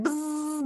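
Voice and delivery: high, drawn-out buzzing; long, high-pitched insect buzz; imitated fly buzz.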